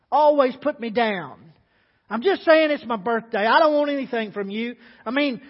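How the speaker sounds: aggrieved, wounded outburst; hurt complaint. Acted. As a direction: plead like a woman tired of being put down. Agnes pleading bitterly in diner.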